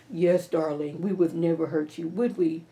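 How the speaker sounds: lightly voiced smooth husband; Hunter voice. Hunter backing Victoria up, low and sweet. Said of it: "That's my Hunter voice".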